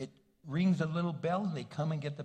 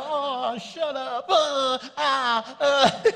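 high, loud laughing groan; 9 semitones higher, 10 dB louder, much slower than usual. Retold or imitated. Husband laughing off wife's teasing, high loud laughing. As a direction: laugh him off then moan. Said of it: "He go".

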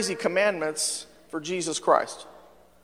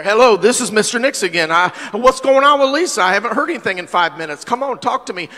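louder, higher, pushy and exasperated; impatient calling; 4 semitones higher, 6 dB louder, faster than usual. Retold or imitated. Pastor bugging nurses by phone, louder and insistent. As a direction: nag the nurses on the phone again.

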